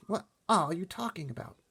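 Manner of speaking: put-on high, light girl's voice; a hint of a bewildered girl; puzzled girl. A narrator's reading. Sophie asks the giant what he means, puzzled. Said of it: "Sophie said".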